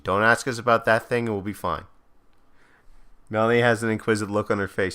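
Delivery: normal talking voice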